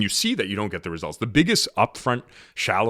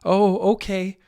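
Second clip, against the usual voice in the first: high-pitched, put-on "oh, okay"; performative reply; 8 semitones higher, slower than usual. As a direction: act it out in a higher voice. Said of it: "the performative version is like".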